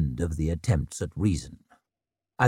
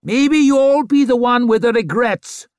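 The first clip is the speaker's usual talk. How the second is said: sly taunt; 12 semitones higher, 7 dB louder than usual. A narrator's reading. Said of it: "called a sly-looking bastard".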